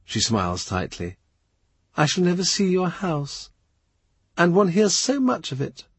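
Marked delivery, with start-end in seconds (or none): 2.0-5.8 wistful lady voice. Acted. Mary Howard wistful.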